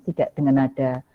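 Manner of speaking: normal talking voice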